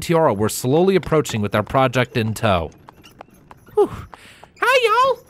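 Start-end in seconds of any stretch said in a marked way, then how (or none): 3.7-5.2 very high, bright filly voice; cheerful Apple Bloom. A narrator's reading. Apple Bloom greeting the ponies, high and cheerful. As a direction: greet them happily. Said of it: "Apple Bloom greeted cheerfully".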